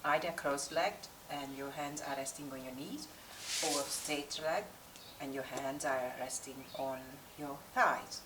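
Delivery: calm, unhurried yoga meditation with long pauses; guided meditation. Real, not acted. Yoga teacher settling class before practice, guided meditation voice. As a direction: guide the class inward, leaving space between instructions.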